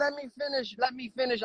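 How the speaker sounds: insistent repeat, high-pitched; repeating words. Real, not acted. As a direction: cut in again and again to hold the floor. Presses to keep talking.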